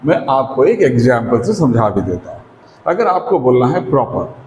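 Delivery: ordinary talk, nothing marked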